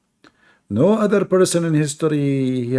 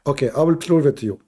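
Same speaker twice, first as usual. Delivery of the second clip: ordinary talk, nothing marked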